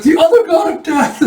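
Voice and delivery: high, loud terrified outburst; hammed-up squeal of horror; mock panic. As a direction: cry out as if seeing death himself. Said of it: "you see [name] just panicking".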